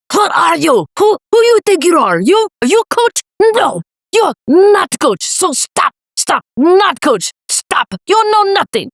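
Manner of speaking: high, loud put-on voice, stretched words; scolding coach. Coach dismissing a tired athlete's complaint, scornful scolding. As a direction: imitate a coach berating an athlete in incredulous outbursts. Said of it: "this is what he said to me".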